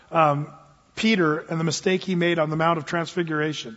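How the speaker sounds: ordinary talk, nothing marked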